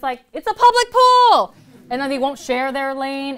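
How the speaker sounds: indignant outburst. Real, not acted. Poet to audience, ranting indignantly about the pool bully. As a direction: let it burst out, fed up.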